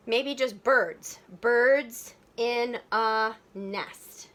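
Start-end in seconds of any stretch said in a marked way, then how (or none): 1.4-4.3 very slow, one beat per syllable; counting out syllables. Shown as a demonstration. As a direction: stretch and separate each syllable. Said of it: "That's only four syllables".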